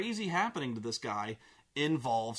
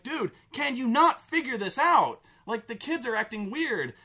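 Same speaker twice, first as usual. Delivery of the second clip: high, louder incredulous plea; exasperated; 6 semitones higher, 4 dB louder than usual. Acted. Player berates the clueless hero, exasperated. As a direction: scold him in disbelief.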